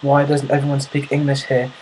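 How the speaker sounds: mock an exasperated Brit firing off questions; put-on British accent, worked up; British, flipping out. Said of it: "British guy flips that".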